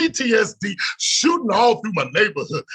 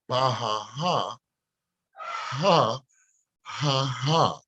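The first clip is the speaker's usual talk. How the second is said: low mocking laugh; 7 semitones lower, 5 dB quieter, much slower than usual. Real, not acted. Laughing at the critics.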